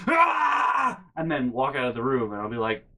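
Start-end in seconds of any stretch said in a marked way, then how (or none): 0.1-1.0 high, loud mock shriek; mom's exasperated scream. Mom answering a question about the trip, mock exasperated scream. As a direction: imitate an angry mother's wordless scream. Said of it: "And she'd be like".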